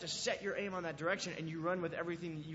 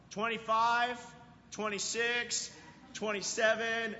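higher, louder calling out the count; 5 semitones higher, 5 dB louder, slower than usual. Retold or imitated. Friends calling the count as his ears surface, loud. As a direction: call the seconds across the pool.

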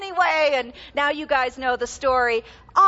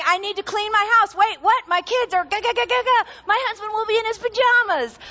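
high, loud flustered babble; mock panic; 8 semitones higher, 3 dB louder than usual. Pretend host panicking about coffee guests, high and flustered. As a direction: stammer like a host caught off guard.

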